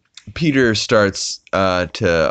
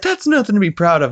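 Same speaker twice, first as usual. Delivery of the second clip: high, fast, mock scolding; scolding townspeople; 13 semitones higher, 4 dB louder, faster than usual. Townspeople scolding Peter, high quick mock rebuke.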